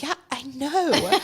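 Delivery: a slow, high, exasperated "yeah, I know"; sarcastic mother mid-birth answering the panicking midwife; high sarcastic drawl. Retold or imitated. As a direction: draw it out, dripping sarcasm.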